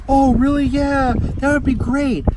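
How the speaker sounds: high, loud burst of relief; delighted acceptance. Retold or imitated. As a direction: act out an eager, grateful passenger. Relieved passenger accepts the offer.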